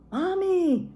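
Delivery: put-on high, loud frightened child's cry; scream. A narrator's reading. Child waking to breaking glass, screaming for mother. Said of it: "I screamed".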